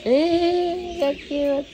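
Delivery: cooing at a tiny pet in a high sing-song; high cutesy coo. Real, not acted. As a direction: gush over the little animal in a high voice. Cooing at a cute animal.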